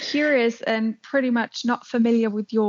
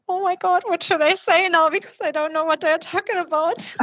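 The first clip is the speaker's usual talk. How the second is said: higher, half-mock flustered surprise; replaying her own bewildered reaction, pitched up; surprised panic; 7 semitones higher than usual. Recalling not understanding Austrians, mock flustered surprise. Retold confusion at Austrian accent.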